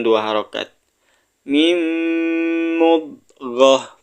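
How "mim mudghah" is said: chanted Arabic with tajwid; drawn-out Arabic recitation; Quran recitation. Shown as a demonstration. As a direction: recite it slowly like a Quran teacher. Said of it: "Kita baca bareng-bareng".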